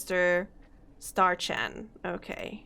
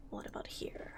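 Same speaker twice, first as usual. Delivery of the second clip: whisper; 16 dB quieter than usual. Real, not acted. Searching the room quietly.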